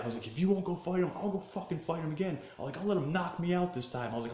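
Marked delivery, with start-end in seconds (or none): 0.3-2.4 high-pitched, indignant retort; retold fired-up anger. Telling friend he'll fight instead, fired up. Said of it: "I'm getting fired up". 2.8-4.1 high-pitched, indignant retort; retold fired-up anger. Telling friend he'll fight instead, fired up. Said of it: "I'm getting fired up".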